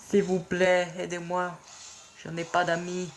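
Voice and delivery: high, pitiful begging; mock pleading. Lone player asking absent teammates for help, mock pleading. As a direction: beg for help like a friendless little soul.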